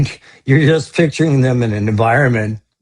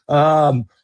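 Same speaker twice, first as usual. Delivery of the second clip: ordinary delivery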